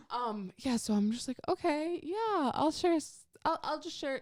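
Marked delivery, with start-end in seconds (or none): 1.5-4.2 higher, hesitant mock-nervous agreement; nervous self. Retelling her nervous Zoom presentation, mock-nervous higher voice. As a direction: play her flustered self giving in.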